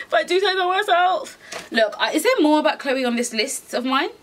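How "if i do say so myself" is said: posh mock voice. Repeats line in another voice.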